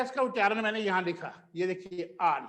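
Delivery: ordinary talk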